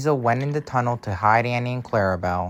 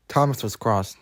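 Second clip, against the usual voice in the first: ordinary delivery, nothing marked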